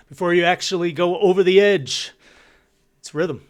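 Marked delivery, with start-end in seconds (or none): none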